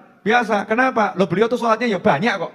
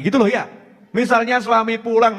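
ordinary talk

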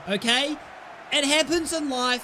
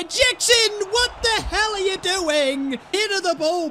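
angry yell; 8 semitones higher than usual. Real, not acted. Yelling at his striker in-game.

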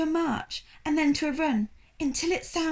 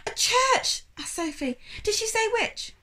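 eager excited question; 11 semitones higher than usual. Acted.

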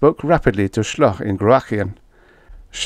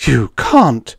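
higher, louder shocked gasp; gasped exclamation; 5 semitones higher, 6 dB louder than usual. A narrator's reading. Miss Mule shocked by Retief's threat, gasped. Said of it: "Miss Mule gasped".

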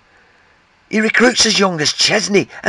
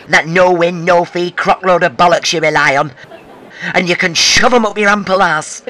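ordinary delivery

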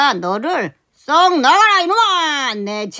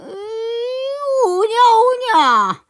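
pained groan; 8 semitones higher, much slower than usual. Acted. Master groans in reply.

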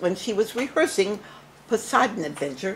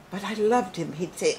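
ordinary delivery

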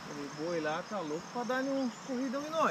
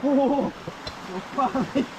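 being chased; 5 semitones higher, 4 dB louder, much slower than usual. Real, not acted. Just outran the bull.

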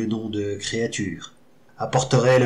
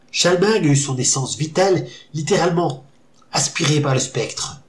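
plain reading voice

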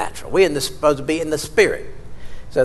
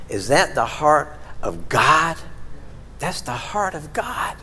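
ordinary talk, nothing marked

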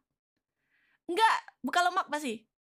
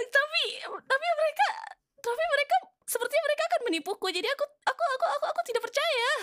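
flustered high voice; 10 semitones higher than usual. Real, not acted. Flustered on call with Rora.

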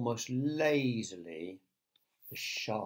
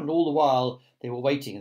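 usual speaking voice

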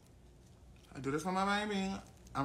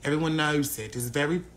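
low, slow mock-British drawl; British accent; 5 semitones lower than usual. American streamer explaining "old English" to Brit, British accent. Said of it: "chill out with that accent".

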